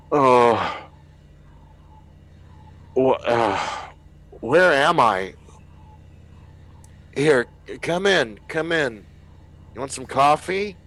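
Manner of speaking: lost and friendly, not knowing where he is; confused survivor. Acted. Dazed survivor welcomes the crew in, offering coffee. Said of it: "he's like".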